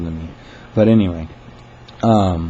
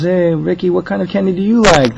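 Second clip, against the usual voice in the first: high, loud sing-song question; manager humoring a caller; 11 semitones higher, 6 dB louder than usual. Retold or imitated. Manager asking a caller about candy, high friendly voice. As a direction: coax a regular caller brightly. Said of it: "And she goes".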